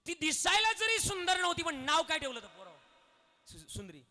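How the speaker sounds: high, loud moral lesson. Acted. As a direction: drive the point home to the audience. Kirtan preaching, raised voice.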